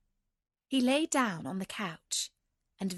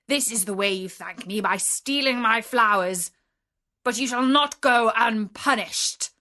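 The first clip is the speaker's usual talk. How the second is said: furious, accusing beast, swelling louder; terrible beast voice; 7 dB louder than usual. A narrator's reading. Beast rebuking the merchant who picked his roses, terrible angry voice. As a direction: thunder it out as the outraged beast. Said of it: "said in a terrible voice".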